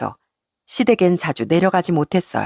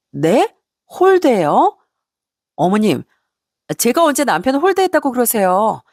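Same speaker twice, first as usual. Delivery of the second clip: taken aback, questions leaping higher; incredulous protest; 4 semitones higher than usual. A narrator's reading. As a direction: object to the accusation in mild disbelief. Daughter-in-law disputes the accusation. (어이없어하며 되묻는 며느리 말투)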